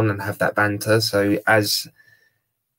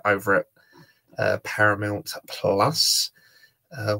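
ordinary talk

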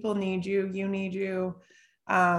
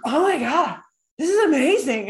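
excited client; 7 semitones higher, 4 dB louder than usual. Retold or imitated. Client reacting to tapping.

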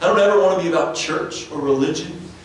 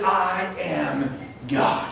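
"I am God" slow and softer; slow soft emphasis; 6 dB quieter, much slower than usual. Shown as a demonstration. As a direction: lay each word down slowly and quietly. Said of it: "No, he says".

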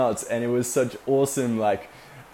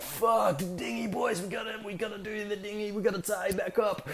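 much higher, softer mock skipper; imitating skipper Glenn; 10 semitones higher, 6 dB quieter than usual. Skipper Glenn ordering the boys about the dinghy, high imitation. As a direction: play the stressed skipper changing his mind. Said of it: "Glenn was like".

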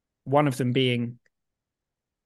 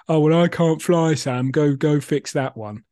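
louder mock protest, imitating a doubter; mock objection; 6 dB louder, slower than usual. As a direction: voice a doubter's challenge, lightly hammed up. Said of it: "people who often do the whole".

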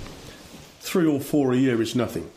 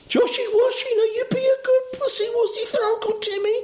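put-on very high baby-talk to a pet; doting cat owner. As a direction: coo in an exaggerated high voice. Owner coaxing cat in story.

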